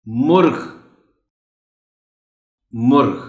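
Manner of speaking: مرغ as one syllable, murgh, no vowel after r; correct pronunciation. Shown as a demonstration. Teacher modelling مرغ, loud single syllable with a vowelless r. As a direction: say مرغ loudly and clearly as MURGH. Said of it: "جزم ہے".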